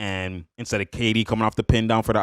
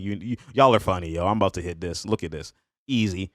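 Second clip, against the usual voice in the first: ordinary talk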